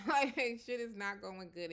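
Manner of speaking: high, loud burst through a laugh; laughing retelling. Retold or imitated. Retelling her warning to her father, laughing.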